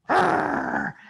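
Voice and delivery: a high, breathless mock growl; menacing dog growl. The pit bull growls at a firecracker bang, mock growl. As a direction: snarl like a startled pit bull. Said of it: "growls this menacing pit bull growl".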